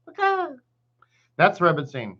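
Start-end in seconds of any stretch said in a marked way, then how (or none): 0.1-0.5 high squeaky bird caw; canary call. Retold or imitated. Teacher mimics the warning canary, squeaky caw. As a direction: chirp it like a little canary. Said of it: "the canary goes".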